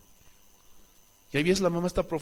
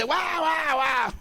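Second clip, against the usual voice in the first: imitated child wailing; 9 semitones higher, much slower than usual. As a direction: ham up a tantrum child's wail. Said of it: "el niño revolcándose".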